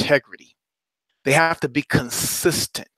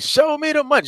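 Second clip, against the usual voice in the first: movie line; 9 semitones higher than usual. Retold or imitated. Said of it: "that movie with Cuba Gooding Junior".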